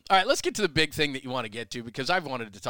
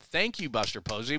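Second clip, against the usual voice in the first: usual speaking voice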